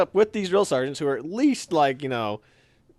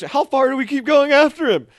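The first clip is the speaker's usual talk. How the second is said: higher and louder, winded chase call; called-out question; 11 semitones higher, 8 dB louder than usual. Retold or imitated. O'Neill yelling to him during the chase, high and loud. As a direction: shout the question across while running. O'Neill asks while chasing.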